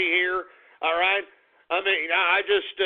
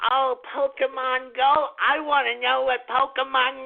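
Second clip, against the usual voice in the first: mocking clueless people; 5 semitones higher than usual. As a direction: parody them as airheads. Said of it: "people are more worried about".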